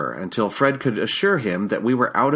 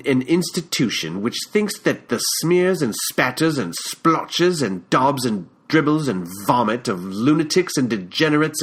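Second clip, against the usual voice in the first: put-on British accent; 2 semitones higher than usual. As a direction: lay out the sneer in a measured British accent. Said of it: "in that British accent of his".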